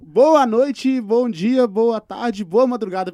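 excited greeting. Acted. Guest restarts his intro.

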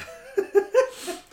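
giggling squeal; high squeaky laugh; high burst of laughter. Real, not acted.

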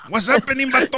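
loud, high mock Chinese-accented English. Host quoting Born in East L.A., mock Chinese accent. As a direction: holler it like a caricatured Chinese accent from a movie. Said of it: "dices como los chinitos de la de Born in East L A".

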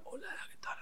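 unvoiced breath, almost no sound; very airy voice. Shown as a demonstration. Vocal coach imitating hoarse students, very airy. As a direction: say it all on air, very soft. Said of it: "así con mucho aire".

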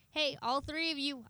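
ordinary delivery

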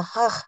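"huh" as a throaty Scottish ch; throaty "ch" sound. Shown as a demonstration. Pupil names the word-ending sound, "huh" said as throaty ch. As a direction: rasp "huh" at the back of the throat. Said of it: "the huh sound at the end of words".

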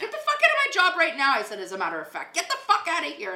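high, loud shouted order to leave; yelling get out, pitch climbing high; furious shout. Retold or imitated. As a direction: throw them off the site at full voice. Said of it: "they looked at me".

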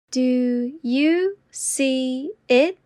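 very slow and deliberate. Shown as a demonstration. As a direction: stretch each word out. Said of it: "repetition drill, slowed take".

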